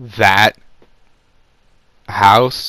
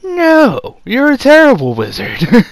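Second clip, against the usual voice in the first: much higher, louder sneering voice; mocking put-down; 8 dB louder than usual. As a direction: jeer at him like a scornful bully. Voldemort mocking Lucius.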